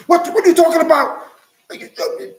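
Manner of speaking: high, loud, fast disbelieving mockery; mock incredulous scoff. The world mocking his Israelite claim, high incredulous scoff. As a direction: play the scoffer who won't believe him.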